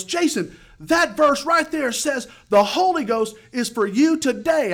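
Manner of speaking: slow, high, loud preacher's shout; excited shouting. Retold or imitated. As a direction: proclaim it fervently over the music. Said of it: "he got excited".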